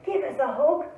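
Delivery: loud, eager call. Acted. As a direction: call out hopefully. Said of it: "cried Douglas".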